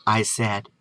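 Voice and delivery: plain reading voice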